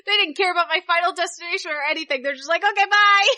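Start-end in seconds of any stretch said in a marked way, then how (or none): 2.6-3.3 high, loud, cheery dismissal; sing-out mock goodbye; imitated breezy send-off. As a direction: mimic a guard happily waving you through. Retelling breezy welcome-back from guards.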